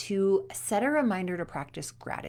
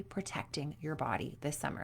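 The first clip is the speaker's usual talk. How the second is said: soft unvoiced whisper of the rule; whispered repeat; 4 semitones lower, 10 dB quieter than usual. Shown as a demonstration. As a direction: whisper it like a secret promise. Coach repeats key rule whispered.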